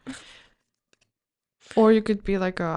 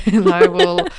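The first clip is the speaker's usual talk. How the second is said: words burst out through a laugh; laughing out loud while talking; 13 dB louder than usual. Real, not acted. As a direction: break into loud laughter mid-phrase. Cracks up mid-sentence.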